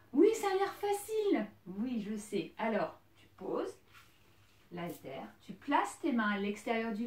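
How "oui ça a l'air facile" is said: higher, louder amused remark. Real, not acted. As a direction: tease that it only looks easy. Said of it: "je rigole parce que".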